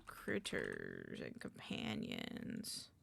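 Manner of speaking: ordinary talk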